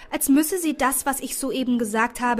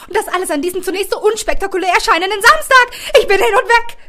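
thrilled gushing; 8 semitones higher, 9 dB louder, faster than usual. Acted. Melissa thrilled by the discovery.

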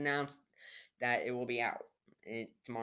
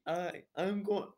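Hawkeye quote; 7 semitones higher, 4 dB louder, slower than usual. Retold or imitated. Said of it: "Hawkeye's like".

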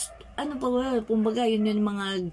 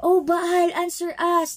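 high, loud cry to a silent god; calling out to Baal; 8 semitones higher, 4 dB louder than usual. Acted. Prophets of Baal calling to their god, loud and pleading. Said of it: "they shouted".

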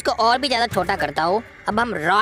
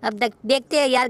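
ordinary delivery

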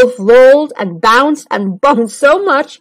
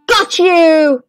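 gleeful shout; 6 semitones higher than usual. Acted. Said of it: "he cried".

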